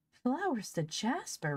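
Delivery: high, slow, baffled repeat; as if he's lost his wits; bewildered. Acted. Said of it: "repeated Polly, afraid Ben had gone".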